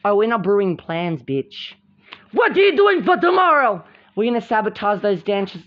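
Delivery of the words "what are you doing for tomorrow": high, loud acted demand; confrontational. Confronting rivals about their plans for tomorrow, high loud demand. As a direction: demand an answer from the plotters.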